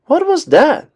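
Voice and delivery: high startled question; startled gasp. A narrator's reading. Minnie hears thumps upstairs, asks high and startled. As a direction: jump at the noise. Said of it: "Minnie gasped".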